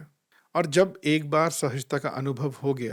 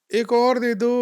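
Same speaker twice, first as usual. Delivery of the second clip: much higher, louder whining call across the house; imitating a friend whining for another roti; strained whiny call; 9 semitones higher, 7 dB louder than usual. Friend calling to his wife for roti, in a much higher, louder whiny call. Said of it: "भाभी को ऐसी ही आवाज़ दे रहा था".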